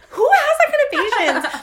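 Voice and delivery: loud, high-pitched mock disbelief; incredulous outburst. Mother amazed at Carly's calm, loud incredulous outburst. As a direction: exclaim it high and loud, half laughing.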